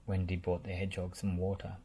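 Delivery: plain reading voice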